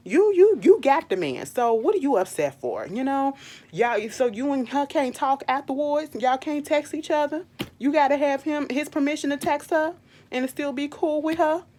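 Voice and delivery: imagined scolding of the wife, loud and worked up; heated telling-off. Retold or imitated. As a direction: tell her off like you mean it, voice up. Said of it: "I said".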